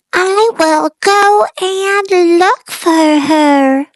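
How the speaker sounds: high little bird voice; a small chick setting off bravely; baby bird voice. A narrator's reading. Baby bird decides to search for his mother, high. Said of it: "he said".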